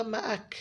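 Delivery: मअक cut off short without the breathy release; मअक chopped off abruptly, no breath; wrong clipped ending. Shown as a demonstration. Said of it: "मअक करके मत छोड़िएगा". (मअक झटके से बंद, बिना हम्स)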